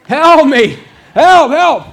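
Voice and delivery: high, very loud panicked yell; screamed cry for help. Retold or imitated. Trapped worker yelling to the boss for help, screaming. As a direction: scream it at the top of your lungs. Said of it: "And so I screamed".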